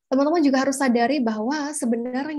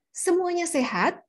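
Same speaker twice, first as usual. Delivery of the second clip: "Semuanya sehat" pitched high with a lift; lively intonation; 5 semitones higher than usual. Shown as a demonstration. Presenter checking on audience, lively intonation. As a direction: call out "Semuanya sehat" brightly to the room. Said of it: "Beda dengan".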